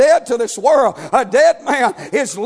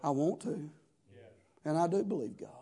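soft, low mock pious prayer; a hint of a showy prayer; lightly voiced pious prayer; 8 semitones lower, 6 dB quieter than usual. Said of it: "bow my knee and say".

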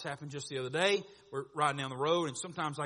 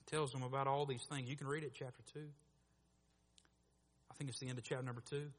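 normal talking voice

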